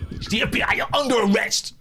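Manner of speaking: high, hammed-up FBI shout; playful yelled bust; re-enacted police raid. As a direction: shout a mock arrest at top pitch. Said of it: "burst in the room and went".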